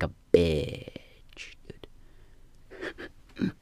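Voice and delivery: slow hushed whisper; whispered aside. Real, not acted. Whispering an aside to the viewers, slow hushed whisper. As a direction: lean in and whisper it slowly. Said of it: "whispered aside".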